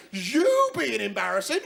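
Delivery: slow, incredulous, rising outrage; indignant retort. Acted. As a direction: throw it back, leaning on the pronouns. Friends answer indignantly.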